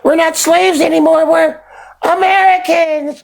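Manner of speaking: hammed-up mock shout claiming to be American; mocking proud boast. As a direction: crow it like a braggart who just arrived. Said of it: "was like".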